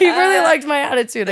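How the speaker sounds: real amused laugh running through it. Real, not acted. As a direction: giggle while saying it. Laughing at own story.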